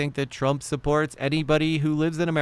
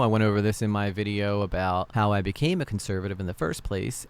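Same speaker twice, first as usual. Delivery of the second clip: usual speaking voice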